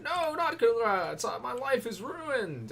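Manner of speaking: high, slow despairing whine; whiny Hans. Retold or imitated. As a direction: moan like life is over. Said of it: "He'd be like".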